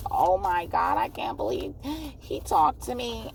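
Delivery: high, amazed fan voice; rising starstruck disbelief. Acted. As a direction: play a fan who can't believe their luck. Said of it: "They're gonna be like".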